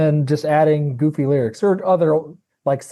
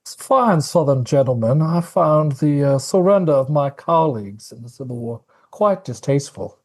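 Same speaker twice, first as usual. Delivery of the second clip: put-on Southern gentleman accent; 2 semitones lower than usual. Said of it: "do your best Southern gentleman accent".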